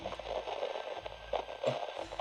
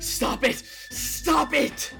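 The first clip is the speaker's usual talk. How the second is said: loud, raised-pitch demand; panicked shouting; 8 semitones higher, 4 dB louder, slower than usual. Acted. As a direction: shout it twice, building. Escalating panic at ghost children.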